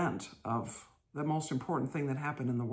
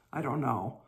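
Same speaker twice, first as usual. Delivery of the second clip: normal talking voice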